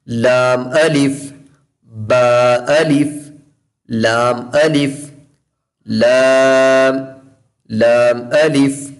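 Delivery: slow, drawn-out letter pairs like लाम अलिफ; slow joined recitation. Shown as a demonstration. Quran teacher reciting letter pairs slowly for children. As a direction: recite each pair calmly and joined. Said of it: "लाम अलिफ ऐसे पढ़ना".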